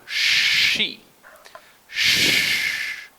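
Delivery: She and Sh hissed loud and unvoiced; sh sound. Shown as a demonstration. Teacher models the sh sound again, loud and unvoiced. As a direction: hiss out a strong Sh. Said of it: "So one more time".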